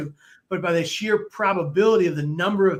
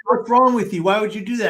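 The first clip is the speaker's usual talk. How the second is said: incredulous, raised voice; judgmental outburst; 4 semitones higher, 5 dB louder than usual. Retold or imitated. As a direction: throw the accusation at them. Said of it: "Or people say".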